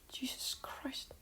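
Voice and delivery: very soft, slow whispered aside; muttered exclamation. Real, not acted. Reacting to a goose going nuts outside, whispered exclamation. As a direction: whisper it under your breath, slowly.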